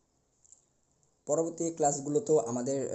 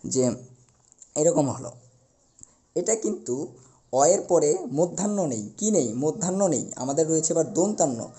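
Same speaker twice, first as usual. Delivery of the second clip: normal talking voice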